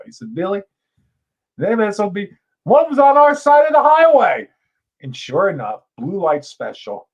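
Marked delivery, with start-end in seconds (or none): 0.3-4.5 rising higher and louder into a country neighbor's alarm; a hint of a country neighbor, getting worked up; excited good old boy. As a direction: build to a hollering neighbor. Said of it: "he's a good old boy".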